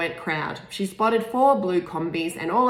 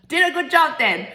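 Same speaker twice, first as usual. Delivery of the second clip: higher, louder half-laughing Irish lilt; Irish accent; 9 semitones higher, 6 dB louder than usual. Acted. Irish security man joking to Peony, laughing Irish accent. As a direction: lightly play the Irishman with a chuckle. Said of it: "His accent made ... unmistakably Irish".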